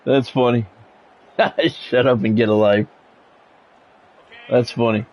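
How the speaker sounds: ordinary talk, nothing marked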